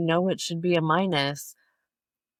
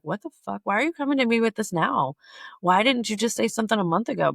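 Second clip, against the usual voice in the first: higher-pitched, incredulous pushback; exasperated comeback; 6 semitones higher, 3 dB louder, faster than usual. Retold or imitated. Speaker answering a friend's month-old grievance, exasperated. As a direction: act out being annoyed at a late complaint. Acted reply to belated complaint.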